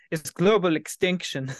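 mock Irish or Scottish accent; imitated Irish accent. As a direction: try a rough, playful Irish accent. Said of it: "just, like, an Irish accent".

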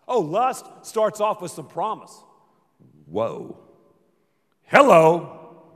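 usual speaking voice

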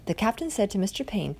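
ordinary delivery

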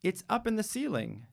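put-on high, bright little girl's call; child shouting. Jamila calling out to her mom, playful child shout. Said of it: "Jamila shouts".

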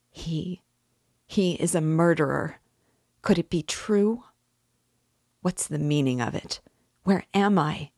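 halting bewilderment, pitch jumping on questions; bewildered. A narrator's reading. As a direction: ask it utterly bewildered, unable to recover. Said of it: "in complete bewilderment".

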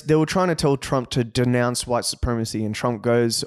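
usual speaking voice

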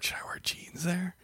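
hushed, unsure whisper on the phone; a breathless whispered question; whispered worry. Retold or imitated. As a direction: whisper it like a nervous secret. Asking family what to wear.

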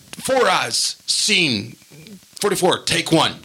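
measured film-slate announcement; slate call. Retold or imitated. Clapper operator announcing the scene, called out.

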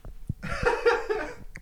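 soft laugh after a sour sip; breathy laugh. Real, not acted. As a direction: laugh at your own sour reaction.

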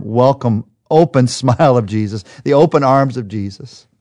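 ordinary delivery

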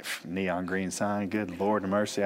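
mildly mad husband muttering to himself; mad grumbling. Retold or imitated. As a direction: grumble crossly under your breath. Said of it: "I went to bed mad".

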